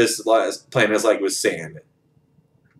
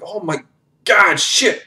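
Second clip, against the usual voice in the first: much higher frantic cry; alarmed cursing; 7 semitones higher than usual. Retold or imitated. Felix shooting and throwing bombs, frantic high cry. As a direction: yelp it mid-firefight. Soldier fighting swarm, cursing.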